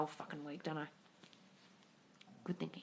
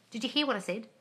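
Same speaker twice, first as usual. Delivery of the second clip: ordinary delivery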